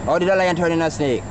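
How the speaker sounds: ordinary talk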